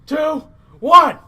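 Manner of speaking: loud, high countdown call; countdown shout. Real, not acted. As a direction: call out the final counts at full voice. Counting down to the chop.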